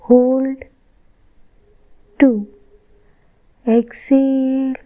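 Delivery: slow, drawn-out inhale and exhale cues; paced breath count. Shown as a demonstration. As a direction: count the breaths evenly, a pause after each word. (साँसों की धीमी, लयबद्ध गिनती)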